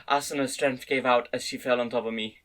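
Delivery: plain reading voice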